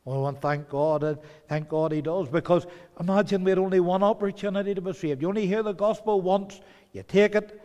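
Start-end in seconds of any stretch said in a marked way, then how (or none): 2.3-7.6 louder, higher, fast urgent preaching; fervent pulpit rise; building preaching. Real, not acted. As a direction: drive the warning home with rising force. Preacher rising to a climax.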